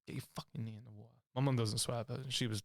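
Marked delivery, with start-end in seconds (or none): 0.1-1.0 low, hushed, aggressive order; aggressive mother. Retold or imitated. Mum ordering child's knee into the bathwater, quietly aggressive. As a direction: keep it low and much softer, but forceful.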